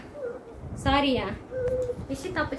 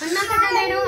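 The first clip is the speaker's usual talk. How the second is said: high loud pleading; 7 semitones higher, 4 dB louder than usual. Real, not acted. Mother over a crying child.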